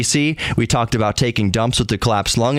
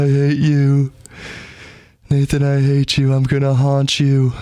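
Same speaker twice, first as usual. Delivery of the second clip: words squeezed out through a held-in laugh, higher; laughing while trying not to; 4 semitones higher than usual. Shown as a demonstration. Showing how he begged Nathan through stifled laughter, strained higher voice. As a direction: talk through a painful suppressed laugh. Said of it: "That's how I try".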